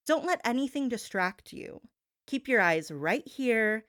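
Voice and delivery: usual speaking voice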